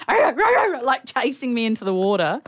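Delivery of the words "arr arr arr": high-pitched mock barks; imitated dog barking. Man's dog chasing someone into the water, imitated barking. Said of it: "his dog's like".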